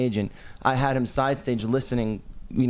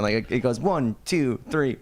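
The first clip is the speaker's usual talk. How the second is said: ordinary talk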